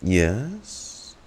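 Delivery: ordinary delivery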